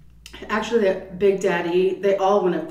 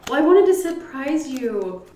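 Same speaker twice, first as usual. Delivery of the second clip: high, louder quoted mother; imitated gleeful mother; 7 semitones higher, 5 dB louder than usual. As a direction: play mom gleefully owning up to the surprise. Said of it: "she was laughing so hard".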